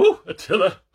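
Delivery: high, loud squeal; hammed-up delighted exclamation; mock excitement. Reader reacts to the book, mock excited squeal. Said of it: "I was kind of like".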